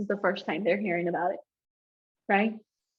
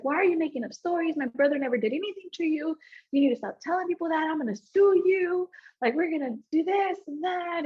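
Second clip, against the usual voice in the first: fast, higher, heated accusations; angry cousin yelling; 6 semitones higher than usual. Retold or imitated. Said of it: "yelling and screaming at my mom".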